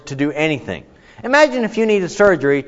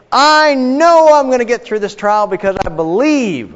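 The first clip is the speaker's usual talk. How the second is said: higher, louder, confident claim; naive believer; 8 semitones higher, 5 dB louder than usual. Retold or imitated. Teacher voicing a blindly confident believer, higher and louder. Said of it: "And so".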